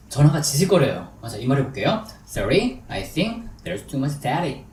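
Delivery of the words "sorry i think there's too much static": slow, with pauses between chunks; deliberate, phrase-by-phrase English demonstration; slow drill model. Shown as a demonstration. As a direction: model it slowly for learners to repeat. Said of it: "천천히 해볼게요".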